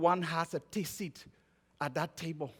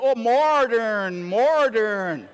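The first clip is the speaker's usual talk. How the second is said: hammed-up American "modern", very slow, heavy r; mock American accent; 3 semitones higher, 5 dB louder, much slower than usual. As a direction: imitate an American stretching "modern" out, loud. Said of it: "And he said".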